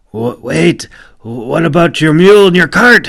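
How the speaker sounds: calling after him. Acted. Boatman calling after the warrior as he walks off, surprised and loud. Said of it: "he asked".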